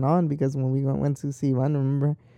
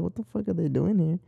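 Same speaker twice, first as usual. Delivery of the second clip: mildly baffled, higher-pitched retelling; confused; 5 semitones higher than usual. Retold or imitated. Finding old classmates at the pick-up, mildly confused. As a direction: retell it with a touch of puzzled disbelief. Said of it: "I was so confused".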